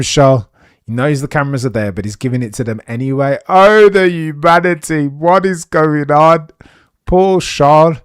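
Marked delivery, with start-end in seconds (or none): 3.5-8.0 slow, loud, hammed-up despair; hammed-up slow lament over a racing blunder; mock tragic lament. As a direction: wail it like a melodrama.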